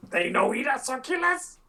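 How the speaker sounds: high, squeaky, half sorry question; half sorry kobold. Acted. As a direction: ask it high and a little apologetic. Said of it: "kinda half sorry".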